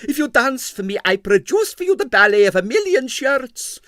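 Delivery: high, loud pleading foreign voice; pleading impresario. Acted. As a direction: beg him with a grand offer. Stroganov pleads with bribe.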